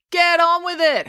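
high, impatient heckle; heckling. A narrator's reading. April to Mister Lang at the microphone, heckling. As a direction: call it out from the crowd. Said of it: "heckled April".

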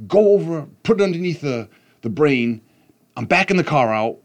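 yelling. Retold or imitated. Dad yelling instructions to son in the garage. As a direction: bark the steps at the kid. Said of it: "So I'm yelling at him".

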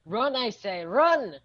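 loud shouted alarm; rally the party to flee; urgent call to run. Acted. Character urges the party out of the collapsing cave, loud shout.

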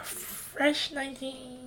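high, slow mocking emphasis; sleazy mock drawl. Real, not acted. Joking about her age, slow and sly. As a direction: drag it out slyly.

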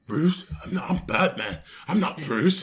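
put-on Batman denying his identity; comic Batman voice; mock Batman. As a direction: imitate a defensive masked hero. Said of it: "He's like".

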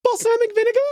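very high puppet voice, puzzled rise; Elmo voice. Retold or imitated. Friend retelling Elmo reading a hard word, very high. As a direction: squeak it out like Elmo struggling. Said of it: "He was like".

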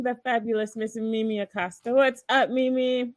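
upbeat radio host welcome. Acted. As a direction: welcome listeners like a DJ. Restarts show welcome.

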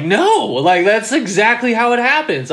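retold high-pitched alarmed objection; flipping out. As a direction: protest with rising panic. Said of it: "I was flipping out".

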